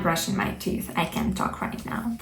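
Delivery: hushed, fading into whisper. Shown as a demonstration. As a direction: whisper as if mouth is busy. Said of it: "I'm doing it now".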